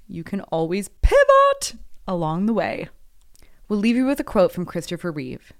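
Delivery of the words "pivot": loud, high catchphrase yell; Ross. Acted. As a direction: belt it out like the sitcom catchphrase. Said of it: "as we know from Ross".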